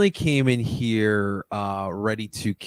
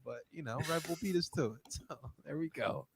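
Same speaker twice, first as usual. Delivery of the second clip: ordinary talk, nothing marked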